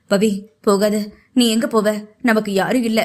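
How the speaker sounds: a hint of a desperate man begging his wife to stay; lightly voiced Preeth. Male character Preeth's voice.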